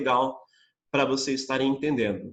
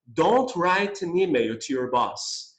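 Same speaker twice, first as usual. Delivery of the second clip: slow clear model; much slower than usual. Shown as a demonstration.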